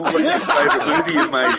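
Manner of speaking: high, laughing through the question; can barely get it out for laughing; giggling as he asks. Retold or imitated. Laughing while asking Arnie.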